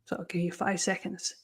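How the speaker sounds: whispered aside to the class. Real, not acted. Teacher whispering to students. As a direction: softly whisper the time limit. Teacher setting timed task.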